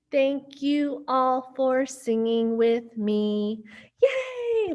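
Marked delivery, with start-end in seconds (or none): 4.0-4.7 high happy cheer. Acted. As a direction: cheer the kids on. Cheering kids after song.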